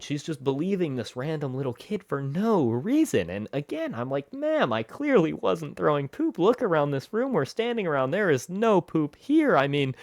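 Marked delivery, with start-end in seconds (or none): 4.3-9.6 higher-pitched, exasperated kid protesting, retold; annoyed protest. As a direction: plead your case, annoyed and pitched up. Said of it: "now I'm starting to get annoyed".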